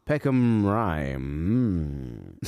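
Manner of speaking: slow announcement sinking into a low hum; train announcer imitation. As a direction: stretch the station name out, then drop low. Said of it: "or as he announced it".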